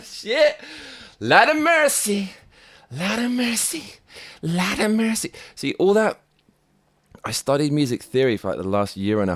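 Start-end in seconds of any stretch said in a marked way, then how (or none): 0.0-5.3 amazed exclamation. Real, not acted. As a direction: marvel at the sound, much higher. Amazed by his own beat.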